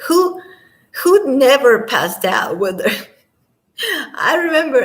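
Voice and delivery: high laughing question; giggly rising question. Real, not acted. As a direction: laugh through the words. Laughing at shared story.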